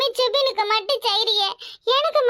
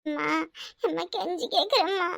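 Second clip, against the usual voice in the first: pleading; 3 semitones lower, 4 dB quieter than usual. Acted. Daughter begging mother for money.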